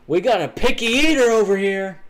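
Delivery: loud teasing. Acted. Teasing announcement.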